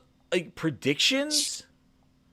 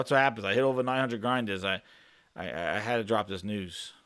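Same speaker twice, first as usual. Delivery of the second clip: ordinary talk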